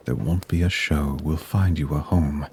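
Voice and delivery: ordinary delivery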